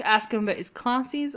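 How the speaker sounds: ordinary delivery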